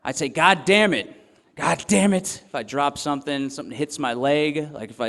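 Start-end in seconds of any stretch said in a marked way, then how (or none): 0.3-2.4 re-enacted louder, higher curse of frustration; stressed-out outburst. Dad showing how he reacts on dropping something, mock exasperated outburst. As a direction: snap it out like something just hit your leg. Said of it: "stressed out at a little bit higher level than the grunt".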